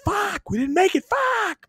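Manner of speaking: high, furious shout of a fan; angry outburst. Retold or imitated. Fan cursing his team missing the playoffs, a furious high shout. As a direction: yell the curse out like your team just lost. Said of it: "so knee jerk and angry".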